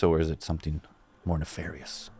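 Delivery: hushed, low spooky tease; mock ominous. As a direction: tease a sinister mystery. Host teases a sinister explanation.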